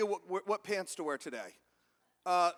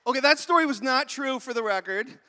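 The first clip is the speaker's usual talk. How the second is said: usual speaking voice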